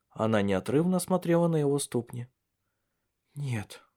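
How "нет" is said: small, soft, crestfallen 'no'; sunken voice. A narrator's reading. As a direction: let the voice drop away, dejected. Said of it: "упавшим голосом ответила она". (упавшим голосом, тихо и удручённо)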